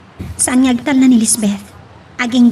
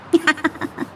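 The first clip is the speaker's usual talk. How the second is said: mocking laugh; 5 semitones higher, 7 dB quieter than usual. [name] laughs at Benji.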